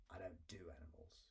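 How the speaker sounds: breathy whisper refusal; whispered brush-off. A narrator's reading. Dragon refusing the girl's cat case, whispered. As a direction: whisper it, dismissive.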